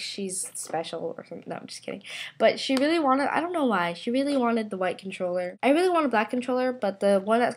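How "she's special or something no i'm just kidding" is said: whispered aside. Real, not acted. Teasing aside about her sister.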